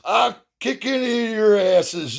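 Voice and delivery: high-pitched mock threat; drunk father. Drunk father threatening other parents at the ballgame, mock high threat. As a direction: imitate a drunk dad picking a fight. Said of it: "this drunk father's".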